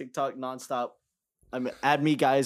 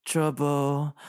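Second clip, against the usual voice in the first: ordinary delivery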